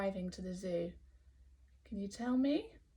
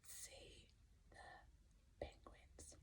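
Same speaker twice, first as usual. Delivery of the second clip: slow whispered words; really quiet voice; 28 dB quieter, much slower than usual. Pretend. Teacher asking the class for a really quiet voice, whispered. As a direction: whisper it very softly and slowly. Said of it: "in a really quiet voice".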